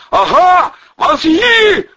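high, loud yell back; shouted confirmation. A narrator's reading. Vovchik confirms his threat to gunman, shouting.

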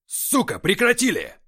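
high, loud furious shout; commanding yell. A narrator's reading. Commander yells from the upper level to stop fighting. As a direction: roar them into stopping. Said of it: "властный голос".

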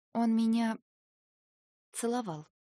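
careful, hesitant reply; cautious admission. A narrator's reading. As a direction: pause mid-phrase, choosing words carefully. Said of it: "осторожно сказала я".

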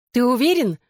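high-pitched insistent question; pressing question. A narrator's reading. Girl urges the narrator to leave, gently insistent. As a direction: press the point, pitch lifted. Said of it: "напирала Кэти".

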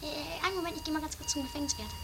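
whispered-ish aside to friends; hushed aside. Acted. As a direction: keep it down so the guard won't hear. Aside away from the guard.

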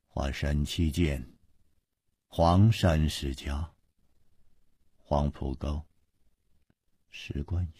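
low, slow murmur, like reciting sutras; muttered recitation. A narrator's reading. Chu Liuxiang sits eyes closed like a meditating monk, muttering as if chanting. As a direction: intone the names softly, with long gaps between them. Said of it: "只听他的嘴里在念念有词，又好像是在念经".

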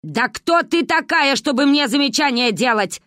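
Ba shouting back at the neighbour; choking with rage. A narrator's reading. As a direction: sputter back in outrage. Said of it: "захлёбывалась в ответ Ба".

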